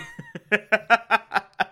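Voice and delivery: high, helpless chuckle; real giggling laugh; amused laugh. Real, not acted. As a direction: laugh in disbelief. Laughs at baffling kid.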